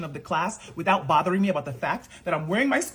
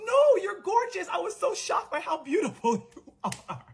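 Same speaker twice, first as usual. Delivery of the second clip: flustered teacher; 12 semitones higher than usual. Retold or imitated. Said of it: "And she goes".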